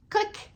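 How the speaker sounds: clicking sound with the teeth; tongue click for the clave beat. Shown as a demonstration. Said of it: "pretend I'm playing a clave here".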